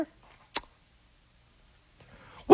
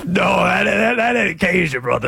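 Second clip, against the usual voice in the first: put-on Cajun accent; 7 semitones lower than usual. Host correcting co-host in a lower Cajun accent. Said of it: "This is Cajun right here".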